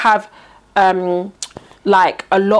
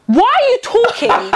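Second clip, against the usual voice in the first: high, slow, incredulous challenge; retold indignation; 9 semitones higher than usual. Retelling a row with a man by the car, indignant. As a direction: act out confronting a nosy stranger. Retold roadside quarrel with a stranger.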